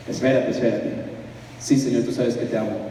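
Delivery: mild low-voiced Peter replying to Jesus; lightly voiced Peter, low. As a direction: answer low and unsure. Jesus and Peter dialogue retold.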